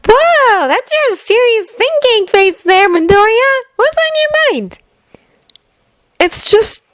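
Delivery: put-on high, slow teasing girl's voice. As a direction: poke fun at his serious face. Cheerful girl teases a friend.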